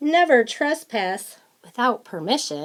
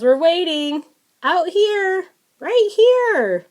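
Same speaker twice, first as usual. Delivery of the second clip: fish voice climbing higher and louder on each phrase; fish calling out; 8 semitones higher, 5 dB louder than usual. Acted. Fish calling to the angler, rising higher and louder. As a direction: call out like a fish waiting in the water. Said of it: "So the fish says".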